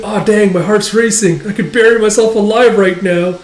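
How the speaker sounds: acted swooning excitement; flustered infatuation. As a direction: play a smitten, excitable young man. Smitten character, heart racing.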